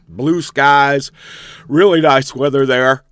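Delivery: talking through a yawn; yawn-stretched words. Real, not acted. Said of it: "Man, am I yawning this morning?".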